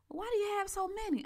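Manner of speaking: high mock uncle voice; uncle's puzzled question. Uncle asking why she owns so many computers, high mock voice. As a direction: squeak out the question like a baffled uncle. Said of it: "And he said".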